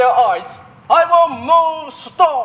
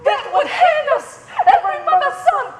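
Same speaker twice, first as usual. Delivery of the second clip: high mocking voice; 12 semitones higher, faster than usual. Mocking the fearful players.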